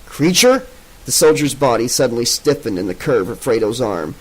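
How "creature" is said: sharp, much higher alarmed question; startled high question. A narrator's reading. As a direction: jolt awake in alarm. Soldier hears the monster returned.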